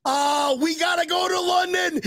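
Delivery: ordinary talk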